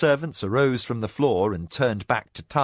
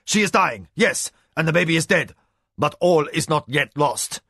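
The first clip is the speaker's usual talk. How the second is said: urgent insistence; 4 semitones higher, 3 dB louder than usual. A narrator's reading. Spymaster forcefully steadying Hokanu.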